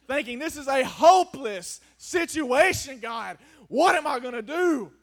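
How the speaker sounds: loud, pleading preacher cry; preaching at full force. Acted. Preacher to his church, crying out in loud despair. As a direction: preach it out like a man begging God.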